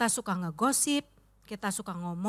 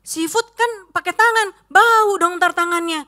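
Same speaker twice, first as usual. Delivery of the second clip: high fussy wife voice; 8 semitones higher, 9 dB louder than usual. Retold or imitated. Wife fussing over smelly hands at dinner, high whiny voice.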